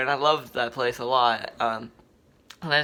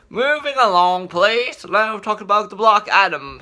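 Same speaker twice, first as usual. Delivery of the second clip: mock British accent, higher and louder; 5 semitones higher, 7 dB louder than usual. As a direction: put on a hammed-up British accent. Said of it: "Don't mind my British accent here".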